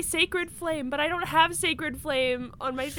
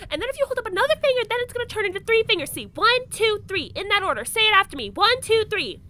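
faster and faster, rising frustration; speeding up; 6 semitones higher, 6 dB louder, faster than usual. Acted. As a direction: rattle it off, building annoyance. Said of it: "she's talking faster and faster the more upset she gets".

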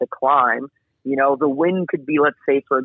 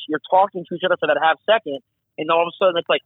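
ordinary delivery

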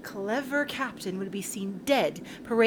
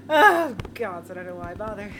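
normal talking voice

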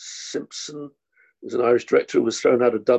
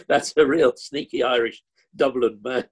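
ordinary delivery

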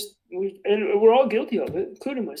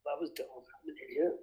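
quiet self-reproach; 5 semitones lower, 12 dB quieter than usual. Retold or imitated. Scolding himself under his breath.

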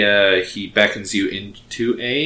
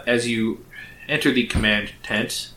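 usual speaking voice

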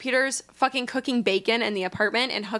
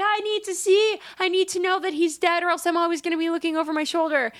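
high, insistent pleading; mock pleading; 7 semitones higher than usual. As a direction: beg to see him, pressing. Tinkerbell insisting.

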